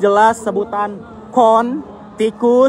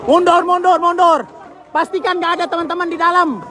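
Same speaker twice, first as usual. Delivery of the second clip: urgent shout; 9 semitones higher than usual. Real, not acted. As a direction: shout them back, then urge. Reporter warns crowd mid-report. (teriak memperingatkan)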